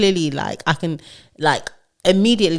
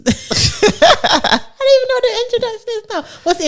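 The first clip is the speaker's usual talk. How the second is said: high-pitched, words squeezed out through laughter; helpless laughter; 3 dB louder than usual. Real, not acted. Host forgetting her own podcast intro, helplessly laughing. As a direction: crack up halfway through speaking.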